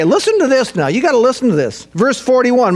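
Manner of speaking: ordinary talk, nothing marked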